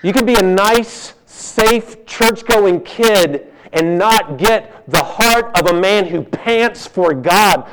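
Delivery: usual speaking voice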